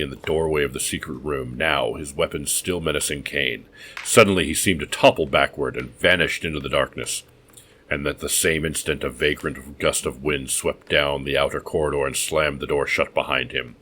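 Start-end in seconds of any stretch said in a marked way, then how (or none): none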